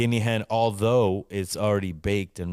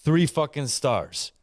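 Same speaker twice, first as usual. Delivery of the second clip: ordinary talk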